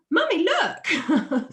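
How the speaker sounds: put-on high, drawn-out little-kid call; imitating a child calling out, high and loud; excited child. Child showing his wasps to mummy, high mock call. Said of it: "And he's like".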